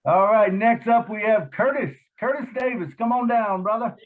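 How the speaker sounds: excited announcer, pitched high and loud; game show host. Pretend. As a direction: call them up like a game show. Introducing next speaker, game-show style.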